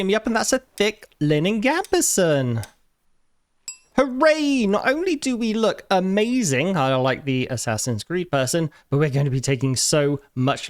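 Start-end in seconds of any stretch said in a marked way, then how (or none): 4.0-6.8 mock cheer. Real, not acted. New armour cheers him up.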